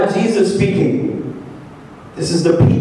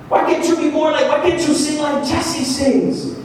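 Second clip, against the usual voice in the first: higher-pitched imitation of a nagging critic; mock nagging; 7 semitones higher than usual. Someone comparing you to more gifted friends, mock nagging. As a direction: nag with insistent comparisons. Voicing people who make comparisons.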